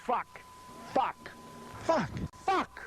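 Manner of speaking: escalating angry swearing; rising curses. Real, not acted. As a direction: frustration climbing curse by curse. Rising curses after a flubbed take.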